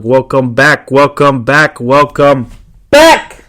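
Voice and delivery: imitating JDC. Host mimicking JDC opening his live stream, mock greeting. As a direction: mimic a livestream host welcoming viewers. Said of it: "His laugh is funny".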